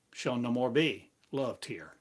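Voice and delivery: ordinary delivery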